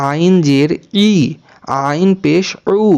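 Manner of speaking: ই and উ drawn out slowly from a tight throat; slow spelling drill, pharyngeal ই and উ; throat-squeezed ain. Shown as a demonstration. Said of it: "গলা একটু চেপে".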